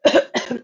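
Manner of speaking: a short dry cough. A narrator's reading. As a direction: cough like a sick creature. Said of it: "he coughed and he whiffed".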